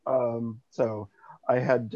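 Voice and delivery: ordinary delivery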